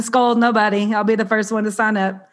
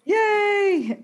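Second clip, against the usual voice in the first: a bright cheer, much higher; excited yay; 12 semitones higher than usual. Real, not acted. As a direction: cheer the guest's arrival. Cheers as guest says hello.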